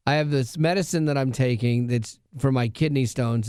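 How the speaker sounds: ordinary talk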